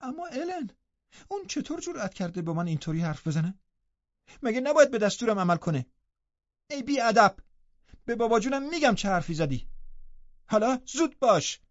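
put-on high-pitched, outraged girl; loud indignation. A narrator's reading. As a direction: protest in a high, affronted voice. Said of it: "با صدای بلند گفت".

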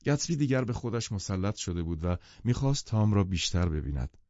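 ordinary delivery

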